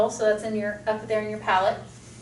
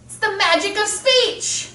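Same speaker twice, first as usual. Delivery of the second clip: higher and slow with delight; excited; 8 semitones higher than usual. Real, not acted. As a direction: marvel at it. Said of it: "I get excited about that".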